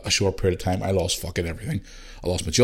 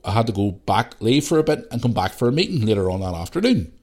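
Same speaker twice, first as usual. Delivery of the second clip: ordinary talk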